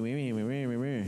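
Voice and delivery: imitated nonsense whine, sing-song grumble; mock whining. Preacher mimicking the people complaining to Moses, mock whine. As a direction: mimic a crowd's wordless whining. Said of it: "and they're whining".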